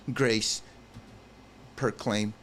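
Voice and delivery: usual speaking voice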